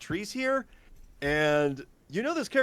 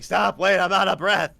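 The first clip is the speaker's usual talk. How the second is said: louder, higher imitation of a winded pursuer; raised-voice plea to stop; calling from behind; 4 semitones higher, 8 dB louder than usual. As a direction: call after someone, mock out of breath. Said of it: "this character behind you saying".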